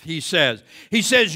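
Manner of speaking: ordinary talk, nothing marked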